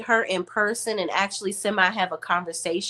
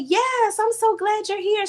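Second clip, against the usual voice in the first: warm excitement; 13 semitones higher than usual. Retold or imitated. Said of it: "she said".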